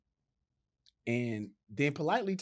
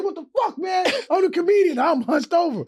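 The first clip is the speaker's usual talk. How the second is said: high, loud indignant yelp; hammed-up high-pitched complaint; pained protest; 9 dB louder than usual. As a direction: cry out in mock agony after a gut punch. Protesting after being punched.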